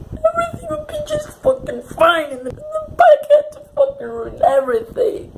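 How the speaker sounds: high, tearful outburst of grief; real crying. Real, not acted. Crying over the plot twist.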